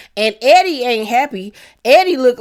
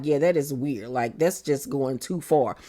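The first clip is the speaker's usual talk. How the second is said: quiet agreement; 6 semitones lower, 7 dB quieter than usual. Retold or imitated. Gina quoted agreeing.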